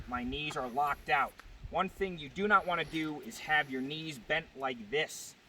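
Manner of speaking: ordinary delivery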